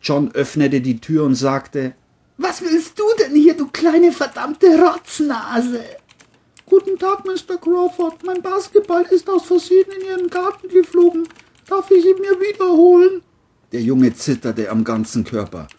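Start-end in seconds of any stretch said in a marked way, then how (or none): 2.4-5.8 high, loud put-on voice, sneering; John's voice. John sneers at the neighbour boy at the door, high and loud. 6.7-13.2 put-on very high, fast child voice; a small kid asking politely; boy's voice. Boy asks the grumpy neighbour for his ball, high and fast.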